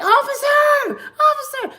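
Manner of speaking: high-pitched call to flag down an officer. Retold or imitated. Flagging police down in retold story.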